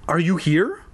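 put-on a touch of timidity in a kid's voice. A narrator's reading. Little brother asks invisible Max, mildly timid high question. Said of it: "Lefty asked timidly".

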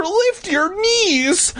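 very high, drawn-out holler; panicked calling. Acted. Boy coaches fleeing sister, panicked high call. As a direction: stretch it into a high frantic call. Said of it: "I called to my sister".

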